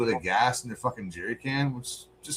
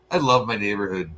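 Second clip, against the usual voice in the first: usual speaking voice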